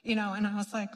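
ordinary delivery

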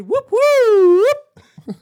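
loud, high police-siren whoop; siren imitation. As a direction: mimic a cop car siren. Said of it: "And they all hear".

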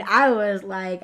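ordinary talk, nothing marked

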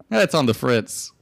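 ordinary delivery

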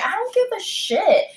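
high, emphatic retold outburst; exasperated dismissal. Waitress dismissing drunk customers' excuse, exasperated. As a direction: reject the excuse with pitch shooting right up.

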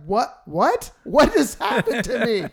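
loud, high, disbelieving What; retelling being stunned, loud and high. Retold or imitated.

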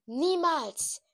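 high loud defiant cry; shouting. A narrator's reading. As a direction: shout the refusal. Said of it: "Darauf schrie ich".

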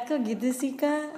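ordinary talk, nothing marked

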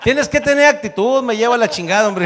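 high-pitched exasperated protest; hammed-up indignant retort; mock indignation. Protests at the audience's reaction.

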